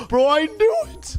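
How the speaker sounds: gasp, then high-pitched exclamation; real high-pitched excitement; excited discovery. Real, not acted. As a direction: burst out on spotting your target. Spots a kid after searching.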